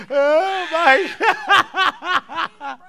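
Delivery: high, loud cry between laughs; laughing exclamation. Real, not acted. Preacher overcome by double tithe, high laughing exclamation. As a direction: gasp it out loudly mid-laughter.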